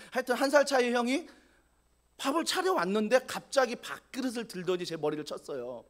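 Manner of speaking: usual speaking voice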